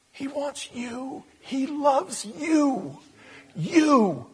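slow, drawn-out gentle words; tender. Real, not acted. Preacher to congregation, slow and tender. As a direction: linger on each word gently.

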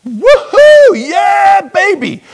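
high, loud celebratory shout; elated whooping. Retold or imitated. Preacher re-enacting his joy at the check, elated whooping. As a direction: whoop it out in jubilation. Retold elation at surprise check.

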